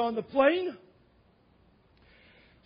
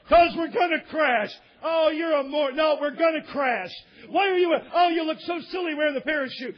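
raised voice, louder and emphatic; acted quarrel; 3 semitones higher, 4 dB louder than usual. Passenger insisting on a crash to mockers, loud quarrel. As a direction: argue over each other, loudly.

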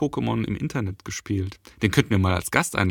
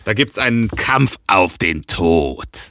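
slow, lower dramatic trailer voice; movie trailer voice; 3 semitones lower, 4 dB louder, much slower than usual. Pretend. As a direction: deliver like an epic trailer. Ominous trailer line.

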